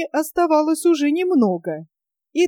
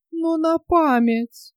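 slow mild wail, pitched higher; plaintive wail; 6 semitones higher, slower than usual. A narrator's reading. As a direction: whine it out like a hurt old woman. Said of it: "жалобно завопила старуха".

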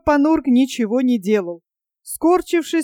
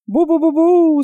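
loud, higher terrified moan; 5 semitones higher, 4 dB louder than usual. Acted. Panurge moans as the storm batters the ship. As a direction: groan it out in pure fright. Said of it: "стонал Панурк".

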